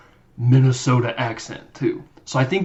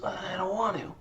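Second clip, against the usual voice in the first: mock sulky refusal; 6 semitones higher, 13 dB quieter than usual. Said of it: "And he goes".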